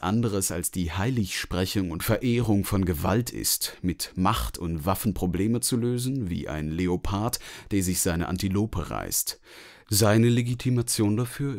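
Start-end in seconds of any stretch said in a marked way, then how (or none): none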